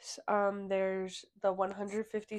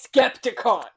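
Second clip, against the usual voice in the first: loud excited exclaim; 13 dB louder, slower than usual. Real, not acted. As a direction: call it out eagerly. Excited at topic.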